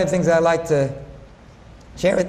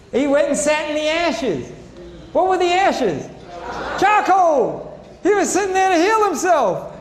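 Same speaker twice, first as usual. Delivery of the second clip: building fervor; 8 semitones higher than usual. Real, not acted. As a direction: build it up like a preacher. Preacher builds to climax.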